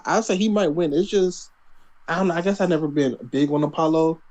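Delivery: ordinary talk, nothing marked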